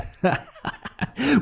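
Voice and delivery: high-pitched manic cackle; mad laugh. A narrator's reading. Madman narrator laughs at own cunning.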